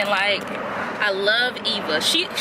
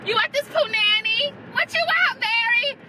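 mocking Iris; 10 semitones higher than usual. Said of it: "she's like".

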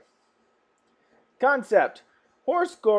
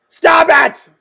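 louder, high-pitched yell; escalated scolding; 8 semitones higher, 10 dB louder than usual. Acted. Reader scolding clowns who keep laughing, high escalated yell. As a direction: raise it to an exasperated yell.

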